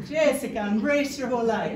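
hammed-up mock Catalan accent; attempted Catalan accent. Shown as a demonstration. Comedian mimicking her mother-in-law, attempted Catalan accent. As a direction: have a go at a Catalan accent, badly. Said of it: "Who's Catalan? Someone say".

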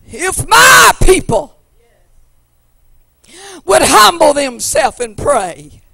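loud, rising preacher's cry over the organ; full-force preaching. Acted. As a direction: drive it home to the congregation.

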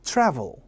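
"travel" with a wide "a", lips pulled sideways; spread-mouth vowel. Shown as a demonstration. Teacher leading the class on vowels, "travel" with a wide-spread vowel. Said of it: "무엇보다 모음이 더 중요해요".

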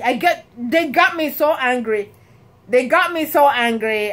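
ordinary talk

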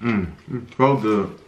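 chewing while talking; talking between bites; mouth full. Real, not acted. Seen 0: Unsure who speaks; both are eating sandwiches, the man seems to chew while talking.

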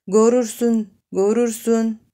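"Gorursun" whispered with a plain o and u in place of ö and ü; a whispered parody of "gorursun", vowels flattened; mocking mispronunciation. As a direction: mimic the coarse "gorursun" under your breath. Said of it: "Görürsün değil".

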